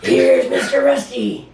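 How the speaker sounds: high excited reveal voice; playful reveal. Real, not acted. Father revealing toy to baby, high and playful. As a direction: pop the toy out with glee.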